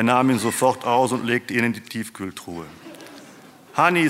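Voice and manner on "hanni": high, loud call of a name. A narrator's reading. Man greets wife home from hairdresser, calling out. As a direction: call to someone across the house. Husband calls his wife.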